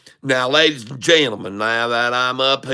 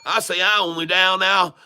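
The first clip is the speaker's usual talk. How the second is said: put-on higher-pitched hollering country boy; 4 semitones higher than usual. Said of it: "switched over to the redneck".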